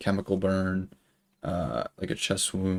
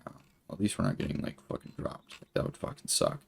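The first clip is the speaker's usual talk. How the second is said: whispered thought; 8 dB quieter than usual. Retold or imitated. Inner relief whispered.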